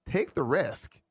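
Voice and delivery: ordinary talk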